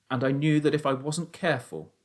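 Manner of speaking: ordinary delivery, nothing marked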